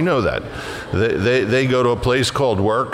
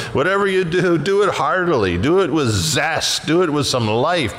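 raised, ringing preacher's voice, each phrase hammered home; fervent preaching; 6 semitones higher than usual. Acted. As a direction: proclaim it like a revival preacher. Preaching the verse with force.

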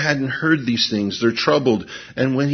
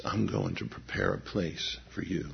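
hushed; 6 semitones lower, 14 dB quieter than usual. Real, not acted. Hushed aside on promise.

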